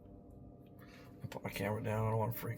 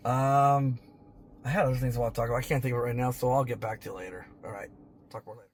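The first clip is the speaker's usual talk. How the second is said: usual speaking voice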